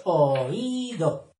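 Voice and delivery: "Oído" in three clear syllables, stress on the í. Shown as a demonstration. Teacher showing viewers the accented word, "Oído" stressed on the í. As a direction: say "Oído" as o-Í-do, leaning on the í. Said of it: "Que va con acento en la I, porque son tres sílabas".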